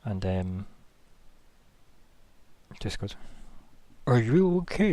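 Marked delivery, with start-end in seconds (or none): 4.1-4.9 high, wobbly gentle question; watery voice. Acted. Creature gently checking on the hero, watery voice. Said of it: "that watery voice that he's got".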